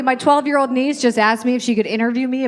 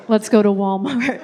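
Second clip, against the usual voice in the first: put-on practised American accent; 3 semitones lower than usual. Irish kid greeting classmates at school, fake American accent. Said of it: "practicing my American accent".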